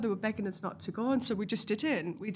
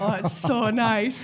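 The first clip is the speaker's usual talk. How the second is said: laughing delight; 9 dB louder, slower than usual. Retold or imitated. Smelling weed on walks.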